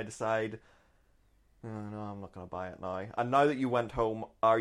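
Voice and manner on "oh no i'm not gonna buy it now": low, very soft retreat; deflated decision. Retold or imitated. Shopper backing out after looking at it, deflated. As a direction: let it drop to a small, quiet aside.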